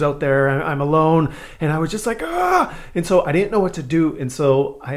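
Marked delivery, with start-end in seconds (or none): 2.2-2.7 high short scream of panic; sudden high panicked cry; panicked yelp. Retold or imitated. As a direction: yelp like a trapped kid. Panicked child trapped in restroom.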